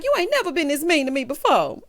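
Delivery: high-pitched wounded protest, hammed up; mock hurt. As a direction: plead like a jilted lover. Retold breakup, hurt woman.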